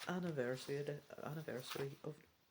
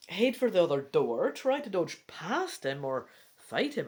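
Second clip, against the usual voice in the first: louder, urgent action reading; dramatic narration; 2 semitones higher, 7 dB louder than usual. Acted. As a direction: build the vampire's attack to a peak. Reads attack scene aloud.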